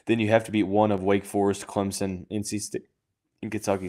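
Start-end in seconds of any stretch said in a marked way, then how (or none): 1.7-3.9 talking through the hiccups. Real, not acted. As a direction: hiccups breaking the words. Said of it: "Sorry, I have the hiccups".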